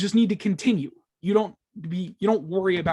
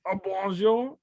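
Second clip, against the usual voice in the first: a hammed-up French bonjour; mock French accent; slower than usual. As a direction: greet like the candle from Beauty and the Beast. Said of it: "I sound like this because of that candle French man".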